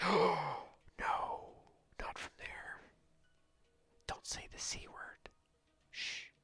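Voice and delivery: gasped oh, dropping into a whisper; horrified whisper. Retold or imitated. Hearing someone is from Connecticut, horrified whisper. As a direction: hush it in mock horror. Acts horror at word Connecticut, hushed.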